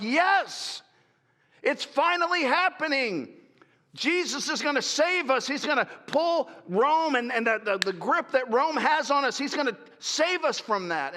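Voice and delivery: high, thrilled cheering; elated crowd voice; excited followers. Acted. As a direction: celebrate like liberation has come. Followers voiced celebrating Jesus.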